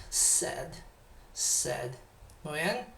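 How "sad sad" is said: "Sad" with an open English vowel. Shown as a demonstration. Teacher repeats the model word for the class. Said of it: "El, el inglés hace mucho eso".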